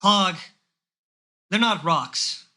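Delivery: put-on weary old man scolding; sigh, then scold. Tim scolds the orc, weary. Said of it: "Tim audibly sighs".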